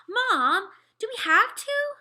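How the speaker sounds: put-on high, loud kid's complaint; protesting whine. A narrator's reading. Boy asked to watch his brother, loud high whine. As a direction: whine loudly at your mom.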